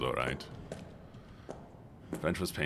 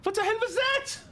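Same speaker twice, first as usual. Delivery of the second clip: very high, shocked cry; startled outburst. Real, not acted. Streamer jumping at a noise, very high and shocked. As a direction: shriek it in sudden alarm.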